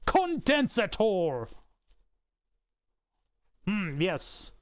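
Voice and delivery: Condensator drawn out slowly in a hammed-up German accent; slow mock-German Condensator. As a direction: bark Condensator like a joke German engineer. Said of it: "Blame the Germans who call it condensator".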